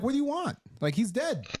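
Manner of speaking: very high, squeaky disbelief; mock incredulous. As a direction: squeak out the question, baffled. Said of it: "I'm like".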